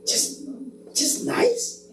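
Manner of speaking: high, breathy, deflated "just nice"; deflated echo. Acted. Pastor mimicking his own disappointed reply, deflated. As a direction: echo it back crestfallen. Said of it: "Y uno dice".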